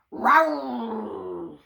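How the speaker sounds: a light mock dragon roar. Dragon roaring at the gardener, a playful mock roar. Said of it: "said the Little Green Dragon".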